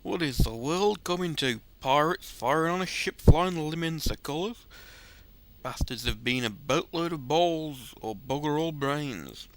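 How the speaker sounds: hammy old seadog brogue, lightly put on; gruff pirate-ish storyteller voice; rough sailor accent. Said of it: "that is an awful accent".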